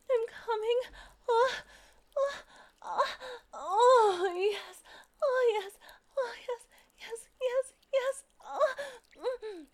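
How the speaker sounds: high, loud, breaking cries of release; losing control, voice climbing and loud; crying out in climax. Acted. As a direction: let it peak in rising high cries.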